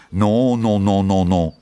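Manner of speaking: drawn-out string of refusals; called-out refusal. A narrator's reading. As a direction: call out a firm string of noes. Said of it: "schudde hij zijn hoofd en riep".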